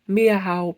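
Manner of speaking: high, loud meow; cat meow. A narrator's reading. Cat chases owl.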